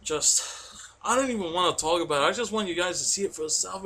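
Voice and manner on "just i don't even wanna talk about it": ordinary delivery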